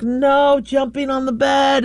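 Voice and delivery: slow, firm scolding; stern command. Retold or imitated. Owner scolding dog, slow firm command. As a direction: lay down the rule to the dog. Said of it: "I'm like".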